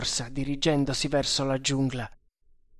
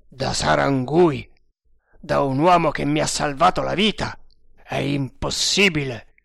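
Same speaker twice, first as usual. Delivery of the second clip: incredulous captain; 6 dB louder than usual. Acted. Captain learning who betrayed him, incredulous.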